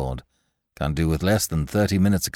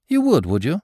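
with a grin; 6 semitones higher than usual. A narrator's reading. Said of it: "said Lord Peter with a grin".

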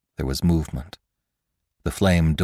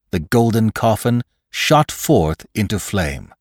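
ordinary delivery

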